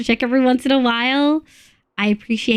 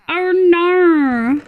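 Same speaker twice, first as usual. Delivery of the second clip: exaggerated Australian accent; 6 semitones higher than usual. Shown as a demonstration. Streamer showing how everyone thinks Australians talk, exaggerated Australian accent. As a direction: stretch it out in hammed-up Australian. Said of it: "he is the Australian accent".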